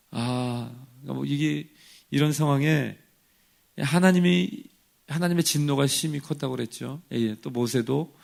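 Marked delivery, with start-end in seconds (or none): none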